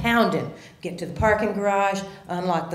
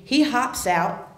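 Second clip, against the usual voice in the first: normal talking voice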